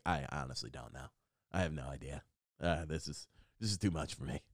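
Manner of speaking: low, soft mock resignation; quiet defeat. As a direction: give up under your voice, acting it out. Retelling his defeated reply to coach.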